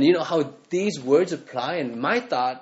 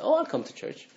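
much higher, softer, fake-sweet voice; mock the shallow friendly believer, sing-song and high; high, breezy mock of people who don't commit; mock nice churchgoer; 10 semitones higher, 5 dB quieter than usual. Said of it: "they say".

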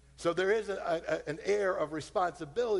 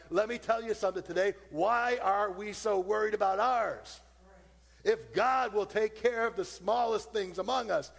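higher, driving sermon climax; preaching at full force; 4 semitones higher than usual. Acted. Preacher assuring the congregation God cares, rising fervor. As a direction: build the call to the crowd.

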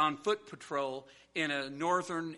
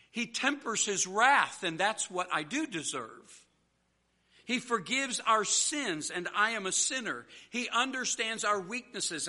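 raised, rhythmic sermon cadence; emphatic preaching; 5 semitones higher, 3 dB louder than usual. Real, not acted. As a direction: build a climax in paired declarations.

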